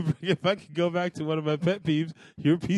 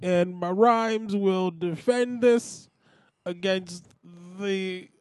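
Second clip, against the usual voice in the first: very slow, drawn-out comedian rhyming; Bill Cosby; slower than usual. Acted. Host starts rhyming about sweaters, Bill Cosby impression. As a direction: declaim each phrase slowly in character. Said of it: "make you rap as Bill Cosby".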